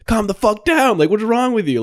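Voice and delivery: high-pitched mock scolding; retold yelling. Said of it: "she starts to yell at him".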